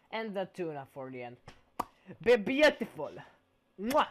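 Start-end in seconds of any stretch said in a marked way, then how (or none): 2.2-4.1 loud, high, hammed-up chef praise; mock Italian chef's kiss. Streamer admiring his pizza like an Italian chef. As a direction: kiss the fingers like a proud chef.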